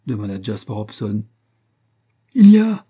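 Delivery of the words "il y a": high, loud outburst; outraged cry. A narrator's reading. Astronomer answering the lieutenant's question, high loud cry. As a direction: burst out at full voice. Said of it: "s'écria l'astronome".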